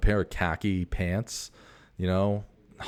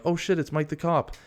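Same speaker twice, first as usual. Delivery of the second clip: surprised recognition; 9 semitones higher than usual. Retold or imitated. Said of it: "they might be like".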